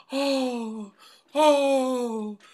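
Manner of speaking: high, drawn-out mock-melodrama "oh"; melodramatic gasp. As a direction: gasp "oh" high and long, hammed-up. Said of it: "coming out of melodrama, she runs up there".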